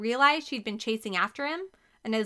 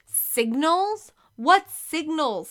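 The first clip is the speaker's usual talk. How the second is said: high, loud, slow demand; 7 semitones higher, 7 dB louder, much slower than usual. A narrator's reading. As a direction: insist on an answer, indignant. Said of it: "Stephanie demanded".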